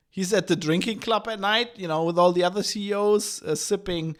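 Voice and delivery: usual speaking voice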